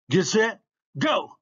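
high, loud start command; starter's shout. A narrator's reading. Starter sending runners off, shouted.

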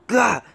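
short, high, loud yelp; sudden "gah" of frustration; real burst of dismay; frustrated cry. Real, not acted.

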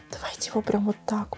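quick hushed whisper. Real, not acted. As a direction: whisper it close. Whispering while showing jar.

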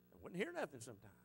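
usual speaking voice

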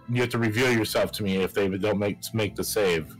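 usual speaking voice

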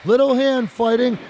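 ordinary delivery

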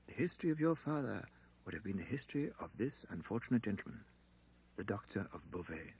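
sinking to a hush; fading hush. A narrator's reading. Banker nearing the painful truth, hushed and fading. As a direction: let the voice fall away gravely. Lorry approaching the secret gravely.

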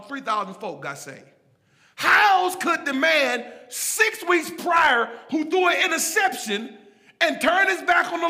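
loud, driving pulpit delivery; full-force preaching. Real, not acted. Preacher driving home grace to his congregation, full-force preaching. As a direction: preach it hard, punching key words.

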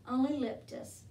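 usual speaking voice

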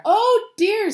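high, loud cry of dismay; alarmed little Rajani. A narrator's reading. Rajani hears the tigers coming, loud high alarm. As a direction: exclaim in high-pitched alarm.

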